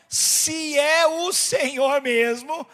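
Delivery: slow, stretched words in an altered voice; loudly spelled-out, testing repeat; drawn-out challenge. Retold or imitated. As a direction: draw out every word of Peter's challenge.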